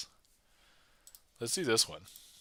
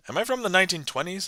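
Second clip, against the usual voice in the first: 1920s radio announcer; faster than usual. Pretend. Magician questioning own accent, in 1920s radio announcer voice. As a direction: put on a vintage radio voice. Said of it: "am I like a radio announcer".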